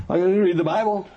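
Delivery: high-pitched, cocksure parody; mock self-assurance. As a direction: mimic someone sure everyone will agree with them. Mocking churchgoers' self-serving unity advice.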